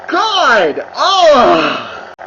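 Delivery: loud, disgusted God and groan; exasperated outburst. Real, not acted. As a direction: let out a fed-up cry of disgust. Fed-up outburst mid-rant.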